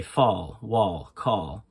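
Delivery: American open AH in "fall, wall, call"; American accent. Shown as a demonstration. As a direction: open the vowel to AH, American style. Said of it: "in America, they would say".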